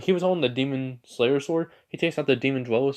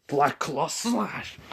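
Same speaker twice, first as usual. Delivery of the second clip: slow attack call; 4 semitones higher, much slower than usual. Retold or imitated. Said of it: "he says".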